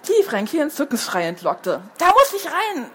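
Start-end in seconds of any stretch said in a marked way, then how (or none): 2.0-2.9 eager call. A narrator's reading. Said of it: "rief er".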